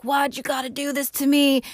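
a mock-angry brother asking why; pissed-off Wyatt. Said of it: "he gets all pissed".

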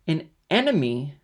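high, loud, angry question; outraged echo, pitch leaping up; indignant cry. A narrator's reading. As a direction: cry out in disbelief and anger. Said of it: "cried Mercédès with an angry look".

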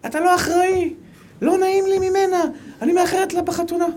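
high-pitched scolding wife, fretting about being late; the wife nags him shrilly from the passenger seat; nagging wife. Retold or imitated. Wife scolding her husband in the car, high and anxious.

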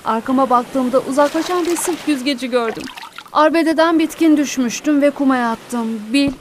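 usual speaking voice

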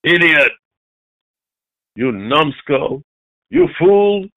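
imitating a teacher insulting children; mock scolding, each insult set apart; forceful put-down, lightly acted; acted name-calling. Voicing teachers' cruel name-calling.

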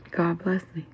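ordinary talk

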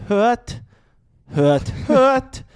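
lautes, hohes Bühnendeutsch; höre, höre, steif und laut deklamiert. Retold or imitated. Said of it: "Bühnendeutsch". (übertriebenes Bühnendeutsch)

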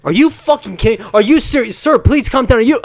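high, loud outraged yelling; freakout shout. Acted. Customer yelling at the clerk over insurance, loud.

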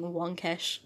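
ordinary talk

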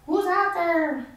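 put-on high, slow old lady calling out; old lady Ullman. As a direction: call out slowly in a high old-woman voice. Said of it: "old lady Ullman would have been like".

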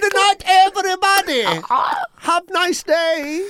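very high, drawn-out farewell; high slow goodbye. Retold or imitated. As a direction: fade out with a squeaky goodbye. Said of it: "it slowly goes".

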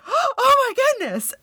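gasp, then a very high "oh my goodness"; a child's shocked squeal. Retold or imitated. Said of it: "Kenzie is like".

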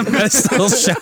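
high, laughing repeat. Real, not acted. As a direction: say it through laughter. Repeating joke while laughing.

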